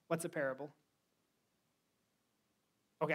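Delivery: usual speaking voice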